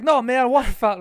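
a light Boston voice, matter-of-fact; Boston guy correcting. Retold or imitated. The Boston guy correcting the narrator, a light Boston voice. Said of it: "He's like".

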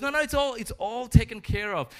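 high, loud breezy assurance; breezy sister. Acted. Sally to Grandma, avoiding dinner duty, breezily assuring. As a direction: brush it off brightly, pitched high and loud. Sally again dodges the chore.

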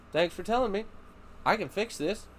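put-on high, gentle praise to a child; sweet reassurance. Dad gently thanking daughter for honesty, high and sweet. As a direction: reassure her kindly after she confesses. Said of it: "And I was like".

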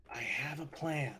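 soft, slow conspiratorial aside; whispering to party. Acted. Whispering a plan to everybody off the dog sled, hushed. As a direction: share a secret plan, slowly and softly. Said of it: "I'm gonna whisper to everybody".

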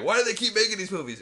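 high, fast, loud complaint; mock mad. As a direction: rattle off an exasperated gripe. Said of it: "I'm always mad".

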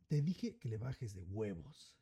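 slow, low threat with a gun; a low, slow warning from the armed father-in-law; menacing old man. Retold or imitated. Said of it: "Se la pone y le dice". (el suegro armado amenaza en voz baja y lenta)

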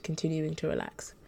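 ordinary delivery, nothing marked

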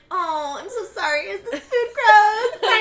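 high, loud, mock-apologetic society lady, slowing on the question; waspy Allison Williams. Podcaster mocking a prim actress, high and loud with laughter. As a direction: ham up a prim, fretful rich woman with a laugh breaking through. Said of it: "such a, like, waspy woman".

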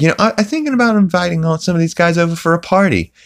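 squeaky rushed mock Peter; high, fast cartoon dad voice. Retelling the show to viewers, fast high cartoon voice. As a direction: rattle it off high and quick, goofy. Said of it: "He's like".

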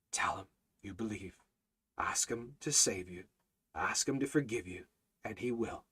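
low, soft pleading with long pauses; hushed altar call. Real, not acted. Preacher inviting listeners to call upon Him, hushed appeal. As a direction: gently urge them, sinking almost to a whisper.

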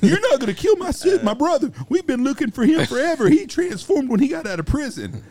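very high, loud, laughing voices; hammed-up shouting match through laughter; mock caller and husband. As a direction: pitch it way up, cracking up. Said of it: "He says".

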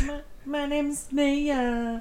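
slow, drawn-out mock self-introduction; mocking imitation. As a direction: drag it out like a goofy impression. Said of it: "I'm gonna be like".

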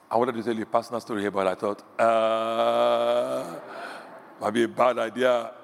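usual speaking voice

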